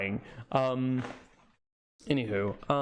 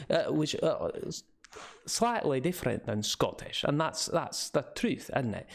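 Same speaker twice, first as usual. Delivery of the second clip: ordinary delivery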